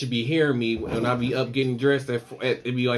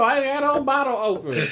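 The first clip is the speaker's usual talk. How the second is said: usual speaking voice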